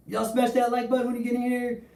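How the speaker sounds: high, pumped-up shout-out; hype call to viewers. Acted. Streamer calling viewers to like.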